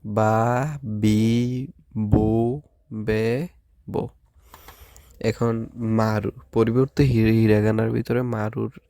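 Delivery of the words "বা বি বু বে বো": বা বি বু বে বো said slowly and separately; repeat after me. Shown as a demonstration. Teacher leading students in repeating kana. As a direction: model each syllable for students to echo. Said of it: "আবার আমার সাথে উচ্চারণ করেন".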